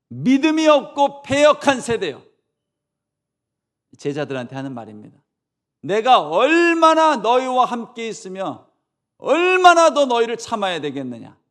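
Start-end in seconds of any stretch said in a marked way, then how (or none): 0.1-2.2 loud, high scolding cry; rebuking shout. Acted. Jesus scolding the disciples, a loud rebuking shout. 5.8-11.4 exasperated rebuke. Acted. Jesus scolding his disciples, loud exasperated rebuke.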